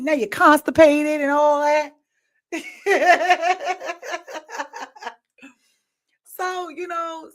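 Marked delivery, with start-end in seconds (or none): 2.5-5.1 long real laugh. Real, not acted. Laughs at own joke.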